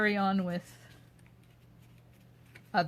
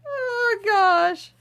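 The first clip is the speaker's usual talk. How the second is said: high squeal of laughter, much louder; real giggling breaking through the words; laughing; 14 semitones higher, 11 dB louder than usual. Real, not acted. Cracking up at a chat comment, laughing out loud.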